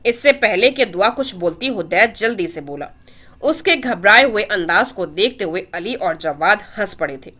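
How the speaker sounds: ordinary delivery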